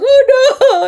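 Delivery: high, loud panicked wail; acted frantic shout. A narrator's reading. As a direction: cry out in childish horror. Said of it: "I shouted".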